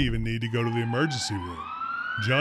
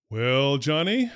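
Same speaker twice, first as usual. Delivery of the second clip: slow, drawn-out opener; slow and deliberate, setting up the punchline; slowly; slower than usual. Retold or imitated. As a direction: stretch it out, taking your time. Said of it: "I slowly say".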